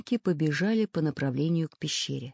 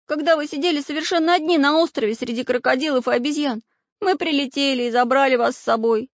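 put-on much higher, louder boy voice, rushing; a hurt boy reminding the traitors; pleading boy; 9 semitones higher, 5 dB louder, faster than usual. Prutik pleads with pirates.

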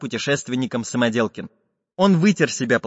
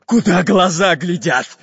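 loud, slow pirate shout, pitched up; Bul-Bul shouting; 5 semitones higher, 6 dB louder than usual. A narrator's reading. Pirate captain answers the confused robber, shouting slowly. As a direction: bellow it at a dim henchman. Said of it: "закричал пират Буль-Буль".

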